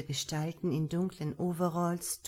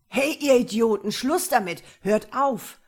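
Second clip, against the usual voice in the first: much louder, higher yell at intruders; desperate yell; 5 semitones higher, 10 dB louder than usual. Acted. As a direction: yell at them to stop. Man tries to stop attackers.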